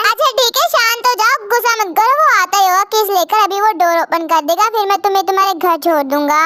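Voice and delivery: low reassuring male voice; calming professor. Acted. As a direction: settle her down gently.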